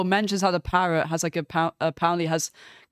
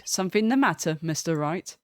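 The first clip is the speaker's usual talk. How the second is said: ordinary delivery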